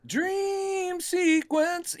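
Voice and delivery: high, drawn-out questioning disbelief; incredulous echo. Real, not acted. Told of Henry's dream sequence, drawn-out incredulity. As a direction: stretch each word very slowly upward.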